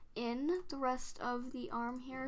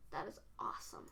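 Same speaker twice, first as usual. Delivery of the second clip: whispering; 6 semitones lower, 13 dB quieter, faster than usual. Real, not acted. Quiet aside while drawing.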